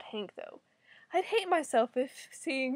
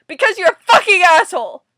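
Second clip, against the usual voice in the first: high, loud insult; outraged, full-volume comeback; furious retort; 5 semitones higher, 15 dB louder than usual. Real, not acted. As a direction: yell the answer at the character. Answers character's question in anger.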